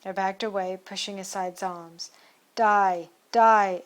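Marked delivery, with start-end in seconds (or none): 2.6-3.8 loud furious double cry; crying die. Acted. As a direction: roar it while lunging. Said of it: "it cried".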